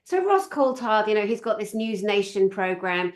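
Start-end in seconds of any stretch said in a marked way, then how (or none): none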